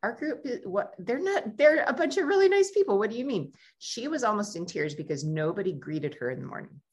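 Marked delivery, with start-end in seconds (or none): 0.0-3.5 surprised disbelief. Retold or imitated. Guide shocked at complaint.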